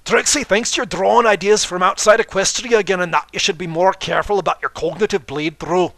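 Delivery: loud, high, theatrical third-person boast; booming grandstanding showmare voice; boastful showman Trixie. Acted. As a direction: proclaim it like a stage magician showing off.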